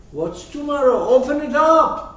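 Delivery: loud, high-pitched challenge; exasperated objection. Acted. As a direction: protest loudly, voice jumping up.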